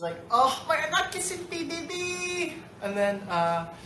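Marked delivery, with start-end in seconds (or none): 0.3-2.5 imitated high, loud, drawn-out squeal; proud and thrilled. Grandson mimics his Lola's pride at the news, proud and thrilled. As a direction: gush slowly as an overjoyed elder. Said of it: "she was so proud of it".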